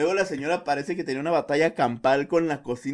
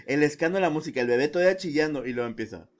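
ordinary talk, nothing marked

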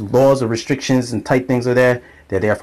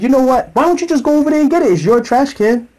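exasperated retort; 12 semitones higher than usual. Retold or imitated. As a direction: snap back at mom, losing patience. Arguing with mom.

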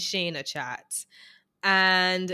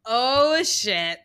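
louder, higher exclamation; shocked oh shit; 5 semitones higher, 6 dB louder than usual. Retold or imitated. Recapper reacting to Shayna's confession, louder in shock. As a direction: react like the twist just hit. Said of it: "I was like".

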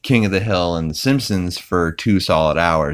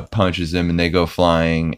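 normal talking voice

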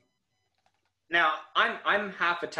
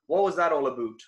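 lightly hammed-up Canadian accent; mock Canadian accent; faster than usual. Host teasing his guest from the Commonwealth, mock Canadian accent. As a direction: tease with a quick Canadian "aboot".

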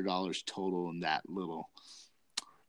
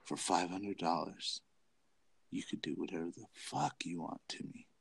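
imitating a whisper; 3 semitones lower, 8 dB quieter than usual. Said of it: "she whispers in my ear".

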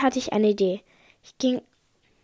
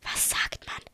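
hushed whispered prompt; soft, voiceless whisper; 10 dB quieter, slower than usual. A narrator's reading. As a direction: whisper it like a mum prompting her children. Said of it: "flüsterte Mama zu uns rüber".

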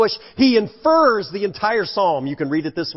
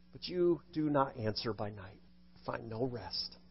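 fading lament; 7 semitones lower, 17 dB quieter than usual. Acted. Preacher reading the psalm's lament, hushed to nothing. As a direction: drop to a near-silent, desolate hush.

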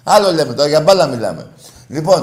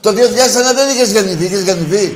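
heated outburst; 6 semitones higher, 7 dB louder than usual. Real, not acted. Host brushing off caller's 2001.

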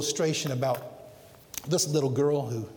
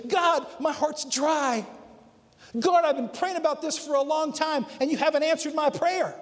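complaining prayer; 8 semitones higher than usual. Retold or imitated. Believer complaining to God, a higher pleading cry. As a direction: whine to God about an unanswered prayer. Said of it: "we go before the Lord and say".

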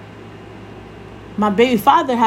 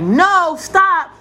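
high, firm parental no; mom voice; 7 semitones higher than usual. Retold or imitated. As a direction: imitate a mother stopping a toddler's mischief. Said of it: "my mom voice".

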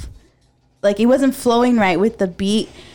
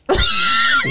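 high squeal laugh. Real, not acted. Sudden glitched squeal.

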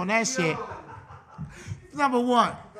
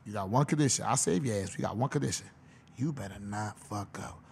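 much lower, softer stern coach voice; Bill Belichick; 11 semitones lower, 8 dB quieter than usual. Retold or imitated. Head coach warning a new signing, low and quiet. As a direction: drop low and quiet like a strict head coach laying down terms. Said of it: "Bill Belichick said".

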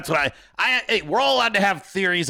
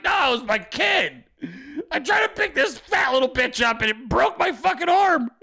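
hammed-up, higher-pitched outraged protest; mock exasperated dad; 6 semitones higher than usual. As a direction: play an injured father protesting. Acted outburst of injured father.

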